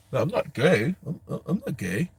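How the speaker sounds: mock indignant, stuttering denial; pissed-off boxer. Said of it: "He was pissed".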